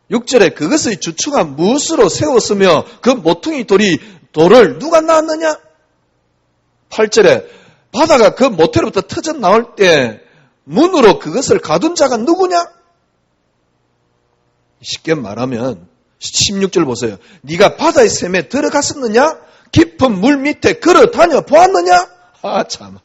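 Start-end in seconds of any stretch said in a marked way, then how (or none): 0.1-12.7 rising, loud proclamation of God's questions; preaching scripture at full force. Acted. Preacher booming God's challenge to Job. 17.4-22.1 rising, loud proclamation of God's questions; preaching scripture at full force. Acted. Preacher booming God's challenge to Job.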